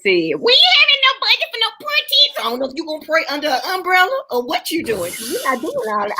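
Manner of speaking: high-pitched, indignant mock quote; Sister Gal. Retelling a sister's refusal to fund the prayer team, high mock indignation. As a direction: imitate a scolding church sister, voice shooting up. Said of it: "Sister Gal has said".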